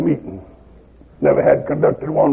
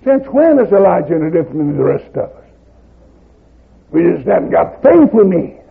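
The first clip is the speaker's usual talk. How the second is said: usual speaking voice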